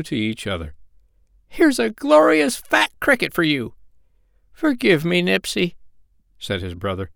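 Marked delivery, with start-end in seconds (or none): 1.5-3.7 very high, piping bird voice; eager peace offering. A narrator's reading. Brothers reunite with a gift. 4.5-5.8 higher, piping little plea; tender apology in a raised bird voice; apologetic sandpiper. A narrator's reading. Brother apologises at reunion.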